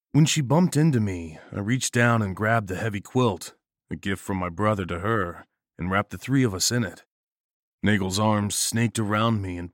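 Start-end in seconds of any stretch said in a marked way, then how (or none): none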